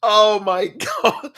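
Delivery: helpless tearful laugh; crying with laughter. Real, not acted. Fan losing it over his own mistakes, crying with laughter. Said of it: "I'm just crying here".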